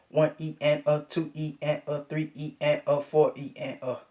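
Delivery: steady hushed subdivision count; soft subdivision count. Shown as a demonstration. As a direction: quietly run the one E and a. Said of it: "That's how I want you to do it".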